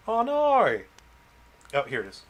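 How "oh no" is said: high loud oh no; dismayed. Real, not acted. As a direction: wail it in dismay. Battery dies mid-stream.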